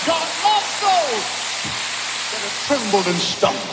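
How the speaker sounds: loud preaching climbing to a high cry; impassioned preaching. Real, not acted. Preacher assures the fallen that God loves them, rising full-force preaching.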